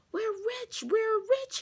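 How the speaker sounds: high, loud joyful shout; excited yell. Acted. Luke running to June with a silver egg, whooping. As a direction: cry it out like you just struck it rich. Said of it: "he yelled".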